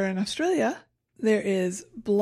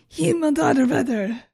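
exclaimed German curse, higher and louder; mock frustration; 4 semitones higher, 6 dB louder than usual. Host imagining a really shitty situation, mock frustrated outburst. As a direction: swear in hammed-up frustration. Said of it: "how I'm going to pronounce my frustration".

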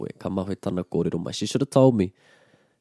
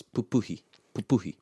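"pupuhi" with the second syllable stressed; stress on middle syllable; 2 semitones higher than usual. Shown as a demonstration. Teacher giving another example word, middle-stressed "pupuhi". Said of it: "The stress is on the middle one".

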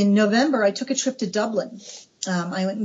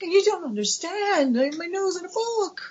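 gushing; 9 semitones higher than usual. Retold or imitated. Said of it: "And I'm like".

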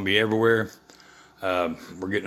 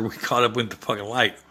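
ordinary talk

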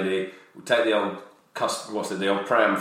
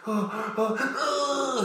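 mock shock; 13 semitones higher, 4 dB quieter, much slower than usual. Recalling shock at real birth.

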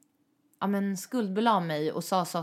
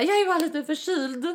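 higher, brave-faced excuse; covering excuse; 10 semitones higher, 4 dB louder than usual. Retold or imitated. Crying at work and brushing off colleagues, higher and brave-faced. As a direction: brush it off while hiding tears. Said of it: "Jag bara".

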